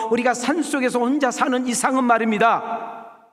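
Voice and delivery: loud, high preaching voice; preaching at full force. Acted. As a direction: drive the point home to the congregation. (힘있게 외치는 설교)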